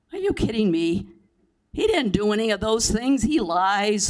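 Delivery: exasperated owner scoffs at the buyer, loud; indignant outburst. Acted. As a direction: blurt it out like the claim is absurd.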